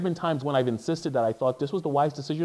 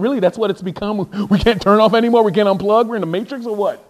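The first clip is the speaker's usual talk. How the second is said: 1 incredulous; 7 semitones higher, 9 dB louder than usual. Retold or imitated.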